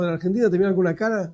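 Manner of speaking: ordinary talk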